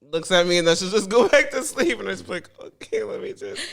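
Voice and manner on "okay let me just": breathy sheepish whisper. Retold or imitated. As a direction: whisper so as not to wake her. Quietly backing off from sleeper.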